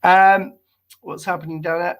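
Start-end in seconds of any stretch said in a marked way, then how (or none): none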